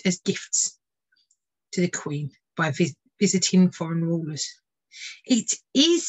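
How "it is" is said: high, thrilled cry; triumphant, voice soaring; delighted ornithologist. Acted. Ornithologist holding the captured bird, thrilled. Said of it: "said the ornithologist".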